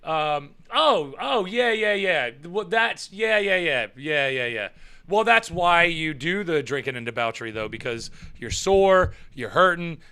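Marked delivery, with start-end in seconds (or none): none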